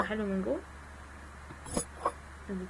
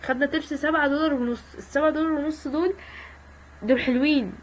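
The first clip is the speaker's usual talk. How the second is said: loud, higher, delighted; excited; 6 semitones higher, 6 dB louder than usual. Real, not acted. Gamer thrilled with her tip, loud delight. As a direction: brag about the tip.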